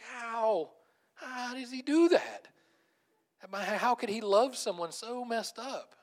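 high, slow, pleading wonder; awed disbelief. Retold or imitated. Preacher asking how God loves the messed-up, awed. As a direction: stretch the question out in amazement. Voicing tearful wonder during worship.

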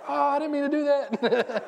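slow, high, loud flustered voice; embarrassed apology. Retold or imitated. Pastor apologizing to the mom, slow and high. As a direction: drag it out, mortified. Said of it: "I was like".

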